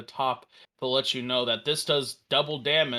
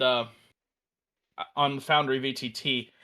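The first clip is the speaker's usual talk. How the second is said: normal talking voice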